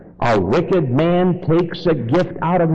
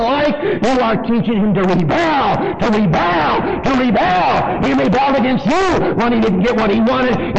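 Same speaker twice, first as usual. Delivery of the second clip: full-force pulpit preaching; fiery preaching; 10 semitones higher than usual. Acted. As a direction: preach it hard and fast, building.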